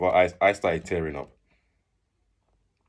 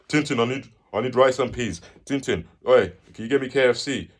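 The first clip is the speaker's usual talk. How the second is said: raised, higher call to a mate; playful, insistent calling out; pestering; 6 semitones higher, 6 dB louder than usual. Retold or imitated. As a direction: nag a friend for food. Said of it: "I used to disturb this guy a lot".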